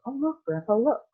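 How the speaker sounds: put-on high, eager child's call; excited boy. A narrator's reading. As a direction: point it out with glee. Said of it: "cried Charlie".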